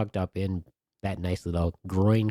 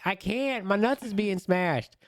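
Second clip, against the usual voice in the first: mock yell; 12 semitones higher, slower than usual. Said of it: "I'm like".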